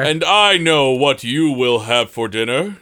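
hammy stage actor. Acted. Knight announces himself.

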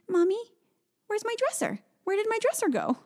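put-on very high puzzled child voice; imitating little girl. Mom retells daughter asking where her dresser went, very high child voice. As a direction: ask it up high like a small confused kid.

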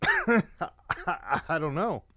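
slow, high laughing mumble. Real, not acted. Remembering the manager while laughing. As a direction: laugh it off helplessly.

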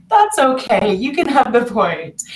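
a mild mock generous yield; giving in at squash. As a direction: imitate yourself giving the point away. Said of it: "And I was like".

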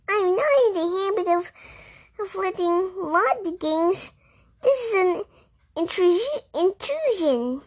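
high, prim, affronted mouse; indignant mouse. A narrator's reading. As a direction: sputter with offence, high.

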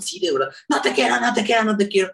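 put-on high child's voice insisting; angry child. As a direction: repeat it like a stubborn little girl. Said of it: "sé que estás muy enojada".

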